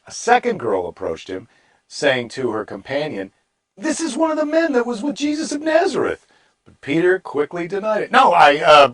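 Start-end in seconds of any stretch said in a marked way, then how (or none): 3.8-6.2 very high put-on girl's voice; second servant girl. 8.1-8.9 loud, higher, stammered protest; flustered denial. Acted. Acts Peter's flustered denial.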